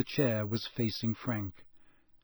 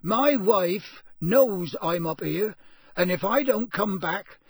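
raised, insistent warning; defiant bluff; 6 semitones higher, 5 dB louder than usual. A narrator's reading. Intruder bluffing on sudden inspiration, defiant warning. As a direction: push the threat out high and firm.

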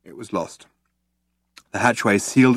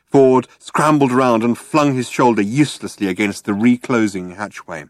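plain reading voice

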